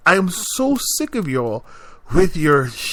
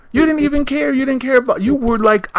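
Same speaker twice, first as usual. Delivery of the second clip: worked-up rant; 10 semitones higher, faster than usual. Real, not acted. Ranting about keep calm.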